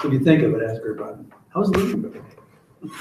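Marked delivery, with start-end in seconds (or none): 1.5-2.9 mock teasing question. Suggested teasing question to ask.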